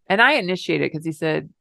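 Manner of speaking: ordinary delivery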